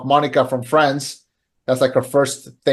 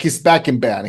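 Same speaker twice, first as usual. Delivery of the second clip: ordinary delivery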